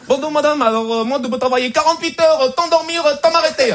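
high, mock Chinese accent; eager job applicant with a Chinese accent. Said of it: "un entretien d'embauche avec l'accent chinois". (accent chinois caricatural, candidat travailleur)

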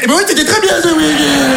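high, laughing sing-song retort; mocking reply. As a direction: fire back with a high, giggly mimic. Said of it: "il peut répondre, il fait". (réplique moqueuse, aiguë, en riant)

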